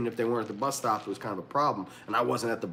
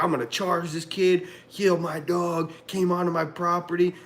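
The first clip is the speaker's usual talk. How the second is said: pissed-off dog owner; 5 semitones higher than usual. Retold or imitated. Storyteller imitating dog owner complaining to cops, mildly pissed off. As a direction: lightly play the outraged owner pressing charges. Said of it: "'cause he was pissed".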